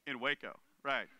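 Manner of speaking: dry, unconvinced scoff; mock skeptical. Everybody scoffing at news of a Waco Messiah, mock doubtful.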